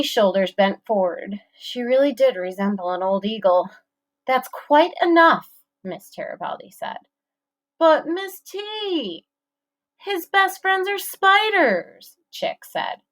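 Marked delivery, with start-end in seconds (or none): 4.3-5.5 stern. A narrator's reading. Teacher scolding. 7.8-12.2 much higher, slow whiny mockery; whiny mocking. A narrator's reading. Bully mocking teacher.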